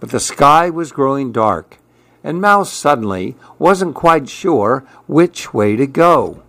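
ordinary delivery, nothing marked

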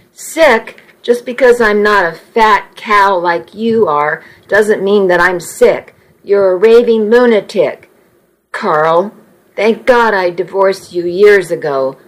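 ordinary delivery, nothing marked

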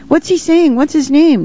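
put-on very high, eager child voice; excited children's questions. A narrator's reading. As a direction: pipe up high like curious kids. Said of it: "questions from the children kept on coming".

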